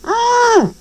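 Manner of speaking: high startled cry; startled engine yelp; muffled cry. A narrator's reading. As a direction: yelp as something lands on you. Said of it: "exclaimed in a muffled voice".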